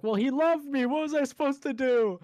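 high, loud mock whine; whiny friend. Imitating the friend whining that the cat loved him. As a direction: plead your helpless excuse. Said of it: "He's like".

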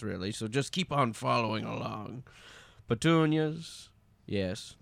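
measured storyteller narration; unhurried narrator setting the scene; story narration. A narrator's reading. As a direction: draw listeners into the tale.

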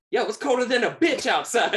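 high, loud laughing impression of a rough lady; laughing crackhead lady voice; mimicking the crackhead lady. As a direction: play her barging in, loud and high.

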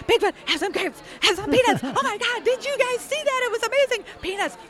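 very high, thrilled calling to Bigfoot; ridiculously excited. Retold or imitated. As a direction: squeal it out, over the top. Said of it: "I'm so ridiculously excited".

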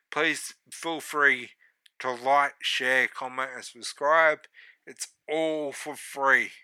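upbeat plug for the channel; promo outro read. Acted. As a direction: read the socials like an announcer.

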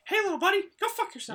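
very high squeaky character greeting; mock mascot voice. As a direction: chirp it like a theme-park character to kids. Imagined greeting to little kids.

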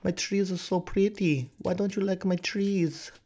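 high-pitched mock Hitler, pleading; Hitler impression. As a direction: play a caricature whining in a high put-on voice. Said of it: "And Hitler was like".

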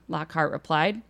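normal talking voice

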